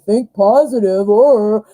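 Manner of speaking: drawn-out, hammed-up pep-talk slogan; mock cliche. Tarot reader mocking the cliche advice she gives, hammed-up and corny. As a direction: parody a corny positive-thinking motto. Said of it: "I know it sounds so cliche".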